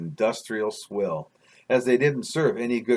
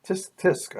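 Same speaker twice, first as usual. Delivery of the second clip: higher-pitched mock tut-tut; musing; 6 semitones higher, 3 dB quieter than usual. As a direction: muse it lightly to yourself, pitched up. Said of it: "I mused".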